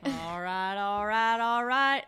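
southern drawl, rising; lazy Texas all right; Matthew McConaughey. Retold or imitated. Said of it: "Matthew McConaughey".

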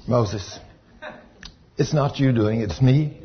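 soft, low voice of God. Retold or imitated. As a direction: speak low and quietly as God calming Moses. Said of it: "God says".

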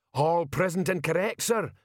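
troll sergeant reporting, pitched high; Detritus. A narrator's reading. Troll saluting Vimes, high-pitched sergeant's report.

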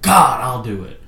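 a sudden loud, fed-up snap; exasperated outburst. Retold or imitated. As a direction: blurt it out, annoyed, then drop it. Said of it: "and he's like".

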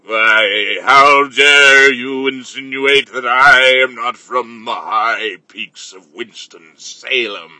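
put-on low, slow outraged old patriarch; Grandfather Cliff voice. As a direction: thunder slowly like an offended grandfather. Grandfather character answers back.

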